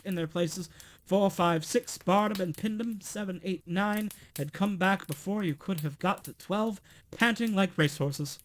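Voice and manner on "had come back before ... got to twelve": usual speaking voice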